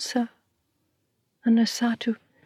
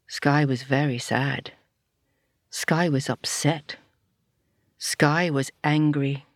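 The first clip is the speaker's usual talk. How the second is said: slow solemn narration; 5 semitones lower, slower than usual. A narrator's reading. Storyteller to children, slow and solemn. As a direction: lower and slow, each line weighed.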